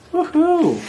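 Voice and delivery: high, happy whoop; celebrating cheer. A narrator's reading. They finished the puzzle, celebrating cheer. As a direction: whoop at the success.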